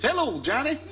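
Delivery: high, sing-out mock greeting; Reverend Watson. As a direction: greet your caller cheerfully in a high voice. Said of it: "And he goes".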